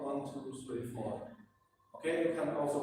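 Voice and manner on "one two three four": fading exhale count. Shown as a demonstration. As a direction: count softer as the breath runs out. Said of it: "breathe out".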